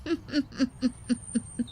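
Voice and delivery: soft, sly snigger; a quiet scheming chuckle; cunning advisor laughing to himself. Acted.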